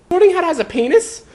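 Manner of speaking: put-on high boyish disbelieving question; high young boy, incredulous; surprised Harry. A narrator's reading. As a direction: blurt it out in disbelief.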